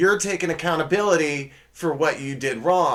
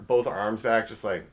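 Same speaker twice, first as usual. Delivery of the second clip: normal talking voice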